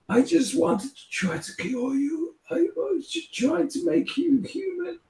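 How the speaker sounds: very high-pitched, soft pleading voice; anguished would-be healer. Acted. Game master as the man explaining his failed cure, high-pitched pleading. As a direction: plead in a thin high voice, far above your own. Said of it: "he just spouts the words of just".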